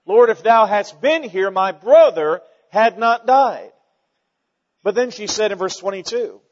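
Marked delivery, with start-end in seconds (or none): 0.0-3.7 louder, slow and accusing. Shown as a demonstration. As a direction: lay the blame on him, slowly. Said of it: "it was also kind of an accusation".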